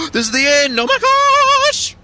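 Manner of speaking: high, loud burst of excitement; thrilled to spot the finish; excited. Real, not acted. Gamer spotting the end of the level, excited cry.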